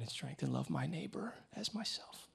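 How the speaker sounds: hushed whisper of scripture. Acted. Pastor reciting the commandment to her, whispered. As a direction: breathe the verse out softly.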